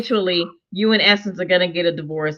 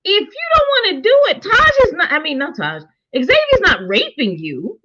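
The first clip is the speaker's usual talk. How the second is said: high, fast frustrated outburst; exasperated; 8 semitones higher than usual. Real, not acted. Frustrated viewer scolding the girl, high and fast. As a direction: yell at the screen in disbelief.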